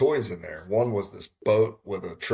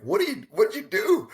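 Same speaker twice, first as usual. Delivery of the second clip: high-pitched, baffled retold question; shocked disbelief; 12 semitones higher than usual. Husband questioning wife's raised hand at auction, shocked. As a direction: act out being caught off guard, voice jumping up.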